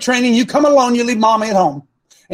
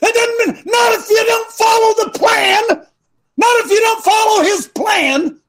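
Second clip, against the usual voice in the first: emphatic shout; 13 semitones higher than usual. Acted. Coach correcting the men with a high emphatic shout. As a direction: hammer the word not.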